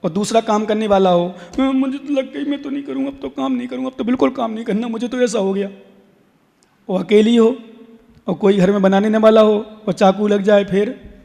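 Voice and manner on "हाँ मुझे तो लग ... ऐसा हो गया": soft, higher-pitched mock complaint; put-on small voice making excuses; complaining shirker. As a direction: act out begging off work after a small cut. Acts out a worker shirking.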